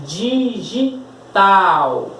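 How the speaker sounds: very slow "Digital", three drawn-out pieces DI-GI-TAL; syllable by syllable. Shown as a demonstration. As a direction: show the class each syllable of "Digital". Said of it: "Observe como eu estou falando". ("Digital" pausado, sílaba por sílaba)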